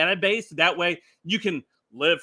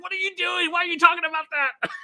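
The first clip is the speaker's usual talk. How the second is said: higher, fast mock-nagging wife voice, quick; imitating Sharon; 11 semitones higher, faster than usual. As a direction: play the exasperated wife, pitched up and rushed. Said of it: "She's like".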